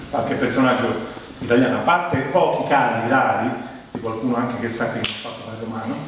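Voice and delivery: ordinary delivery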